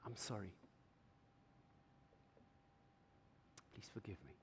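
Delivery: very soft, hushed apology. Retold or imitated. Father softly apologizing to his hurt child. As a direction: murmur it with humility.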